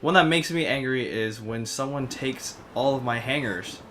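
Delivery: ordinary delivery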